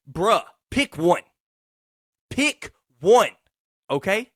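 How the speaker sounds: fed up, calling it out; exasperated. Real, not acted. Exasperated at the orthodontist.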